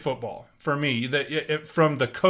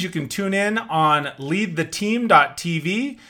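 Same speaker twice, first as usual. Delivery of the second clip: ordinary delivery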